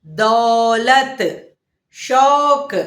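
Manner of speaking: Gita drawn out very slowly, syllable by syllable; slow classroom enunciation; slow teaching demo. Shown as a demonstration. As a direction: spell out Gita slowly for children. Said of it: "ओ की मात्रा में आएंगे".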